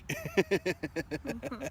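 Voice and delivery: high, playful call. Pretend. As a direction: shout the name out with glee. Calling out the statue's name.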